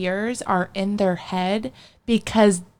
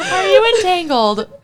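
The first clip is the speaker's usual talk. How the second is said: laughing comic echo; 10 semitones higher, 6 dB louder than usual. Real, not acted. Friend echoing in comic voice.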